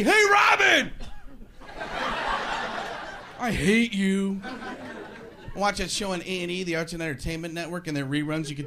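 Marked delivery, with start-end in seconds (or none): 0.0-4.4 yelling at the TV. Retold or imitated. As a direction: shout at the TV host. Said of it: "yelling at the T V".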